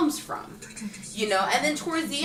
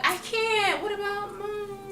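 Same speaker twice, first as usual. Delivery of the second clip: put-on pleading girlfriend; 10 semitones higher than usual.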